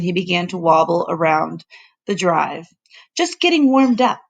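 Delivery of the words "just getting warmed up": announced with a grin. A narrator's reading. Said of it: "he announced with a grin".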